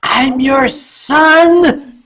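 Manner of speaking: high, loud, drawn-out mock wail; Chester. Costumed son owns up to his mother, loud drawn-out wail. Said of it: "And Chester said".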